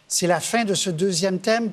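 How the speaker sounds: ordinary talk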